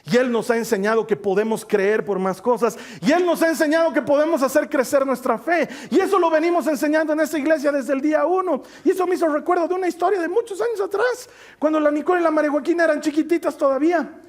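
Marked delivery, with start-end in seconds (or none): 3.0-14.2 fervent preaching. Acted. Preaching at full force.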